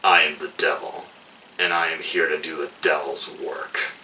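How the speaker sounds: low menacing villain. Retold or imitated. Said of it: "glaring down at him, says".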